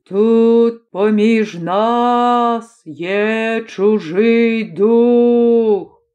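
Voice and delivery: very slow, long-drawn chant on every word; droning, drawn-out ghostly announcement; eerie spirit call. A narrator's reading. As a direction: stretch each vowel out like a haunting summons. Otherworldly voice announcing an intruder.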